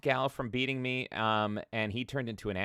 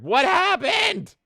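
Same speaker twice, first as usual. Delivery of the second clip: surprised; 8 dB louder, much slower than usual. Real, not acted. Reacts to chat message.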